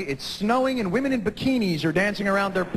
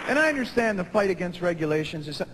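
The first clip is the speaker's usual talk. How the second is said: usual speaking voice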